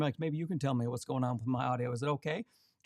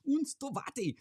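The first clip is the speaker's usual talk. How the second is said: playful put-on German accent, pitched much higher; mock German accent; 12 semitones higher than usual. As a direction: toss the name out in a jokey German accent. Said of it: "that's not a German accent at all".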